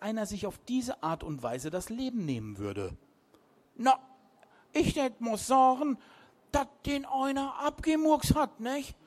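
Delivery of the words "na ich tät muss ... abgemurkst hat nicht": much higher, louder sea dog voice; old captain insinuating. A narrator's reading. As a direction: lean in and suggest foul play. Piet claims murder.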